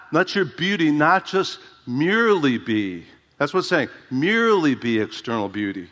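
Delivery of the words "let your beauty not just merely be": ordinary delivery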